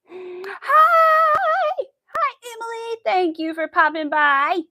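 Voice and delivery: high, loud, drawn-out hi; excited greeting. Real, not acted. Streamer welcomes a viewer popping by, excited. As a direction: greet them with a big, high squeal of welcome.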